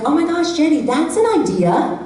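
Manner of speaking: high mock enthusiasm; imitating coworker. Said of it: "she would be like".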